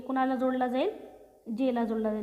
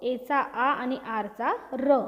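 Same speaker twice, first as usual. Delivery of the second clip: each letter's sound drawn out slowly, one by one; much slower than usual. Shown as a demonstration. Teacher to pupils, slowly sounding out each letter. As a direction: spell the word into sounds, slowly for the class. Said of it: "सीचा उच्चार क".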